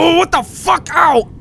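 high startled shriek; scared horror game streamer. Real, not acted.

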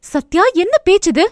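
high-pitched, indignant rebuke; appalled telling-off; scolding. A narrator's reading. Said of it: "அதட்டினாள் Abhinaya".

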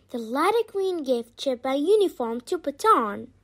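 ordinary delivery, nothing marked

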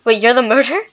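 loud shocked question; surprised. Real, not acted. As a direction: blurt it out in disbelief. Shock at murderer reveal.

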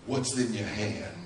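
low, hushed, unhurried question; quiet voice of God. Acted. God calmly questioning a panicking Moses, hushed and low. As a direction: drop deep and soft, utterly unruffled.

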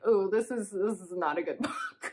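laughing through the words. Retold or imitated. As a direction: giggle while judging the book. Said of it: "I was kind of laughing when I was reading".

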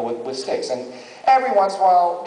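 ordinary talk, nothing marked

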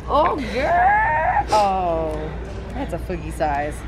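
ordinary talk, nothing marked

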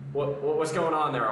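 normal talking voice

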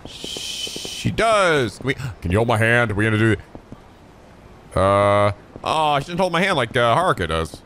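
ordinary talk

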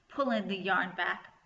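usual speaking voice

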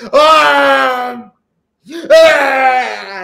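loud high retching yell; retching shout. Retold or imitated. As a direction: imitate a loud gagging cry. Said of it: "Así le hacía".

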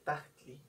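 "tahtli" with a hard, fully sounded tl; strong tl. Shown as a demonstration. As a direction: punch the tl in "tahtli". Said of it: "se llega a escuchar muy fuerte".

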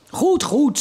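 put-on father yells at the noisy kids to stop; shouting father. A narrator's reading. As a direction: give in with a mock yell. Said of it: "schreeuwde hij".

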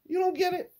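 very high, loud squeaky question; incredulous. Real, not acted. Comedian checking on listeners who missed the punchline, incredulous squeal. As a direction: shoot way up in disbelief.

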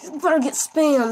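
hyped-up trash-talk shout; loud taunt. Real, not acted. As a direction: yell it like a cocky gamer. Taunting enemy in game.

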